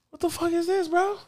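squeaky high, hushed-shock question; incredulous friends. Retold or imitated. Friends stare at four foil sticks, amazed. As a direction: imitate stunned buddies in falsetto. Friends stunned by smuggled blunts.

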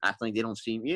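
ordinary delivery

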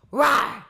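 loud high roar; animal roar. Pretend. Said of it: "theme of animal noises".

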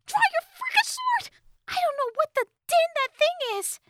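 frantic, very high squeaky fairy voice; urgent fairy. A narrator's reading. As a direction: order him urgently in a tiny high pitch. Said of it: "she whispered urgently".

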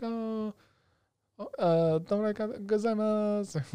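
high, slow little-girl imitation; voiced little girl. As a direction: imitate a shy girl haltingly saying thanks. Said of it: "She's like".

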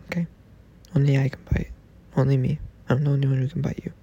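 ordinary delivery

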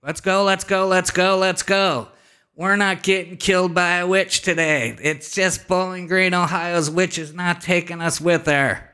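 high, loud, insistent yell; rally the group to flee; urgent rallying shout. Retold or imitated. Acted urgent escape from witch.

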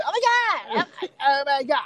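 very high mock squeal; over-peppy girl. Mimicking girls squeezing their shoulders, high squealed parody. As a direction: squeeze it out high like a tensed-up, too-perky girl. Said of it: "squeezing their shoulders".

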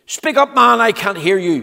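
high, loud bellow; bellowing butcher. Acted. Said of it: "bellowed the butcher".

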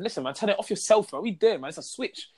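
mock exasperation, pitch up; exasperated retort. Telling girlfriend to switch it off herself, exasperated.

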